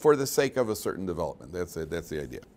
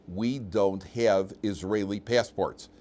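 slow, deliberate retold statement; wife's measured reply; much slower than usual. Wife back on the phone to husband, slow and deliberate. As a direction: imitate her spelling it out word by word, slowly. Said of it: "and she says".

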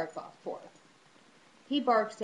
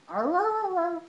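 high, loud playful imitation of a growl, made to coax the dog; mock dog growl; 9 semitones higher, 6 dB louder, slower than usual. Shown as a demonstration. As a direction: growl like a dog so the pug copies you. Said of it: "your funny noises?".

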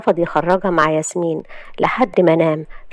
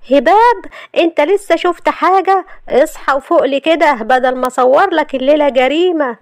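much higher, heated threat; furious retort; 8 semitones higher, 4 dB louder than usual. Acted. Wife threatening husband after finding evidence, furious. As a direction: throw it back at him in fury.

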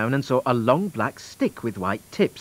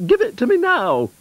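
high-pitched, insistent cow voice; demanding cow; 5 dB louder than usual. Acted. Blue Cow orders the wand, demanding cow voice.

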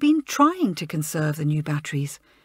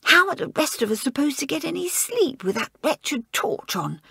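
loud whisper; 6 semitones higher than usual. Acted. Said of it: "she whispered loudly".

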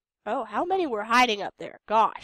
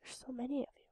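hushed, breathy whisper at the screen; 2 semitones higher, 12 dB quieter, much faster than usual. Real, not acted. Gamer whispering at swarming enemies, hushed. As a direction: whisper it as if not to be overheard.

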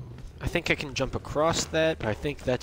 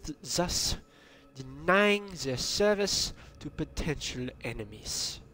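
put-on horrible German-American accent; 3 semitones higher than usual. Gamer reading a secret document aloud, bad German-American accent. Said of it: "a horrible German-American accent".

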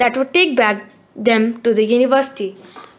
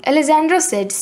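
ordinary delivery, nothing marked

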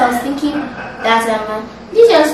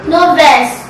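raised voice; 3 semitones higher, 7 dB louder than usual. Real, not acted. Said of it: "No, no the verse".

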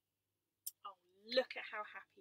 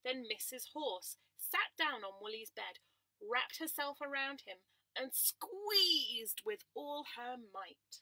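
gentle storytime narration; reading to children; 3 semitones higher, 8 dB louder, slower than usual. A narrator's reading.

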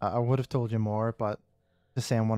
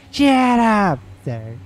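very slow, higher, louder cartoon bark in a put-on voice; South Park catchphrase; 8 semitones higher, 7 dB louder, much slower than usual. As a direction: drag it out like a cranky cartoon guard. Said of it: "He goes".

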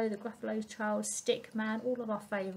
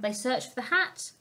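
ordinary delivery

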